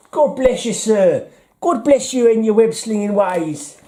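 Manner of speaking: high, hammed-up corny English accent, Mary Poppins style; mock cockney charm in a corny English accent. Mocking Marvel's corny English accent in comics. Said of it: "really corny English accent".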